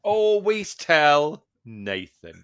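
"Always tell Nathan" drawn out slowly; slow repeat. Acted. Repeating the trainer's rule to his co-host, slowly. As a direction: repeat the lesson like a mantra.

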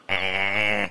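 long Scottish "Eh"; unconvincing Scottish accent attempt. Shown as a demonstration. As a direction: practise a put-on Scottish sound. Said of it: "It's Scottish".

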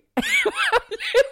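high burst of laughter. Real, not acted. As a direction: break into a big laugh.

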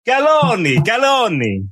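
Italian name sung out high with a stretched middle; hammed-up Italian announcer flourish on the surname; mock Italian accent. Shown as a demonstration. Host asks guest how to introduce him, mock Italian accent. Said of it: "I don't want to do an Italian accent".

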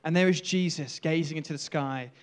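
ordinary delivery

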